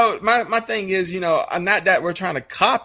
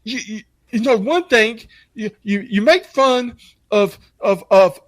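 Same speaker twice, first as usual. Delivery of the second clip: high stammering whine; mocking offended kid; 8 semitones higher than usual. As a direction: stutter it like a scandalized youngster. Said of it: "She's like".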